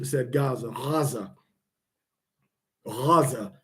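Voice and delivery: "Gaza" with a soft throaty R-like start, "Raza"; "Gaza" begun with an open guttural G, never closing the throat; Arabic ghayin. Shown as a demonstration. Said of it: "the way the Arabs actually say Gaza".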